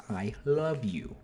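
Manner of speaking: slow, drawn-out I love you; slow demo of I love you; said slowly. Shown as a demonstration. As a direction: stretch each word of I love you out slowly. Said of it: "Say it slowly".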